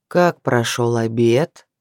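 low, slow, menacing question; sinister voice. Acted. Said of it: "Зловещим голосом". (зловещим тихим голосом)

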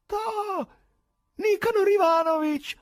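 high, loud, tremulous tenor shout; tremulous tenor cry. A narrator's reading. Stranger greets the house chairman, quavering high tenor cry. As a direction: leap up and cry out his name in a quavering tenor. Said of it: "urlò ... con tremolante voce tenorile".

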